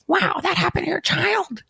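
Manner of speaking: high cartoonish mock Jordan Peterson; a squeaky mocking Jordan Peterson take; gravelly Jordan Peterson. Mocking Jordan Peterson's podcast sympathy, squeaky voice. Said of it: "overly gravelly voice".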